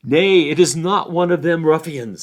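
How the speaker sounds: a wary farmer calling out; gruff challenge. Acted. Farmer confronting stranger at night.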